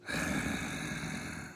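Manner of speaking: a long heavy breath on the line; imitated deep breath. Mysterious caller breathes down the phone, heavy breath. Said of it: "It was like".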